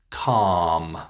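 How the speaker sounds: "calm" said cahm, long vowel. Shown as a demonstration. Teacher models word for practice, long ah in "calm". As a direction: hold the ah in "calm". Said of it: "It's the long ah sound".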